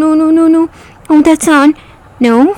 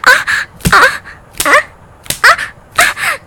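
high sobbing cries; 9 semitones higher than usual. Acted. Acted crying cries.

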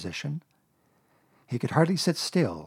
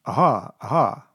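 slow, careful rehearsed exclamations; Pooh practicing; 7 dB louder than usual. A narrator's reading. Pooh practicing the word to himself, slow and deliberate. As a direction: try it out slowly, twice.

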